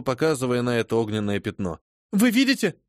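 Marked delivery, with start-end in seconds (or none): 2.1-2.8 frightened. Acted. Phoebe terrified at fire.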